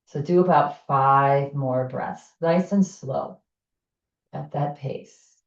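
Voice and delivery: pace the listener through each inhale and exhale; guided breathing. Acted. Leads a breathing exercise.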